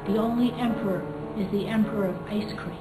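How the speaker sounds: ordinary delivery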